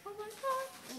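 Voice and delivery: very high delighted squeal; high gushing "Oh my God"; cooing at kittens. Retold or imitated. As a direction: squeal over cute kittens.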